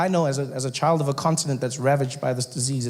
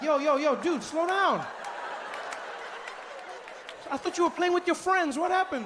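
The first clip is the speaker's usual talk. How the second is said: big brother calming him; 11 semitones higher, 6 dB quieter than usual. Retold or imitated. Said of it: "I'll be like".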